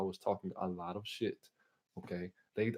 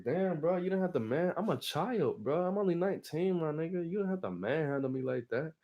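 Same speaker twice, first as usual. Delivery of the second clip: higher-pitched aggrieved whine; hammed-up wounded complaint; indignant protest; 6 semitones higher than usual. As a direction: plead your case like a wronged kid. Retold protest at being manhandled.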